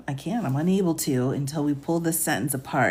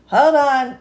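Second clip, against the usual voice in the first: exasperated hold on; 8 semitones higher, 8 dB louder than usual. Real, not acted. Stopping herself mid-read, exasperated.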